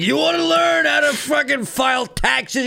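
high mock shout of a bitter old dude; grumpy boomer. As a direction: rant it like a jaded boomer. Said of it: "rugged, disheveled, beaten down dude".

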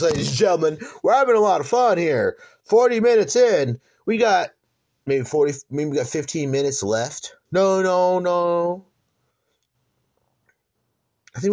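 loud, high hype-man energy; hype announcer. Real, not acted. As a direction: pump up the crowd, ladies and gentlemen. Host hypes the show.

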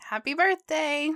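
bright, loud birthday cheer; excited. Real, not acted. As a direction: call it out happily. Birthday mocktail toast.

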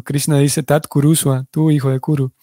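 ordinary delivery

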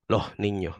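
"Los niños" said as "loh niño" with h for s; aspirated s. Shown as a demonstration. Repeating the Andalusian plural: aspirated s. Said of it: "yo digo".

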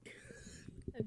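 soft unvoiced whisper. Real, not acted. Teasing co-host about ASMR, whispered.

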